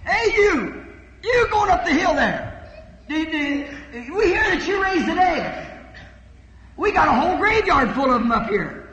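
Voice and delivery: loud, high jeering call up the hill; mocking priests. Priests jeering at Jesus passing by, loud high mockery. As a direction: taunt him like a scornful priest yelling from a graveyard. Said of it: "he heard priests saying".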